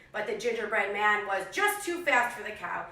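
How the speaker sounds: put-on lively read-aloud for young kids; animated picture-book narration; storybook reading. A narrator's reading. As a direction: tell it like a classroom story time. Teacher reads story to class.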